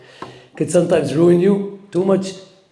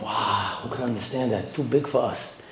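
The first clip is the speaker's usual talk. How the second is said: much softer, low wondering murmur; hushed awe; 4 semitones lower, 10 dB quieter than usual. Retold or imitated. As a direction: say it like a stunned, small voice. Voicing a baffled believer.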